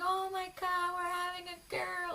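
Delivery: very high, sing-song happy scream; overjoyed squeal. Retold or imitated. Mom-in-law reacts to the gender reveal, overjoyed high squeal. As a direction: imitate a delighted grandma-to-be squealing. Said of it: "she was so happy".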